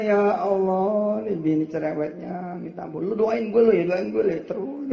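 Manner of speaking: loud, high exasperated prayer; mock complaining prayer. Husband griping to God about his wife, loud and high.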